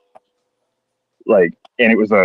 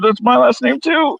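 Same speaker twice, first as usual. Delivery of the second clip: high, slow shocked exclamation; shocked shout; 13 semitones higher, slower than usual. Retold or imitated. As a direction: yell it out in horror. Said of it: "And I was like".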